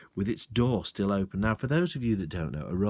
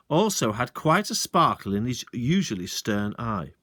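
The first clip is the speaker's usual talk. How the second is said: ordinary delivery, nothing marked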